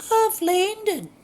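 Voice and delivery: high, loud breathless gasp of a line; gasped, hammed-up. Woman just off the plane, gasping out her news. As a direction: squeal it out in a shocked falsetto. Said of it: "she gasped".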